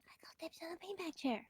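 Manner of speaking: hushed whisper. Real, not acted. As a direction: whisper it under your breath. Quiet room, whispering.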